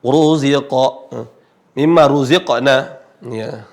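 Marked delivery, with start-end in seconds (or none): 0.0-1.1 Ruziqa slowly, ru heavy with the tongue back raised; heavy Arabic ru; thick ru. Shown as a demonstration. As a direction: sound Ruziqa with a full, heavy ru. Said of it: "dibaca tebal".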